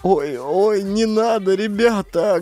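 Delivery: high-pitched pleading wail. Acted. Varvara to the groping gang, wailing. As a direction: wail for mercy in a high woman's voice. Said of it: "голосила уже Варвара".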